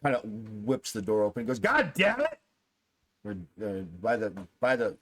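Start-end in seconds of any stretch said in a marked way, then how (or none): 1.6-2.4 high, exasperated outburst; upset. Acted. Upset man whips the door open on the hallway, exasperated outburst. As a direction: burst out loudly, pitch jumping up. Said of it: "sounds like he's upset".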